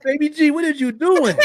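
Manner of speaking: loud, high-pitched incredulous question; amused exclamation. Retold or imitated. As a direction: call out in mock exasperation at a grandchild. Grandpa retells questioning whispering grandchild.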